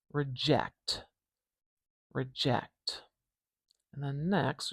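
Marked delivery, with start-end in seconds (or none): 0.1-3.0 Reject, reject, eh vowel in -ject clear; slow careful word demo. Shown as a demonstration. Teacher repeating Reject for the dress vowel. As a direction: model Reject slowly, stressing the eh. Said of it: "dress vowel".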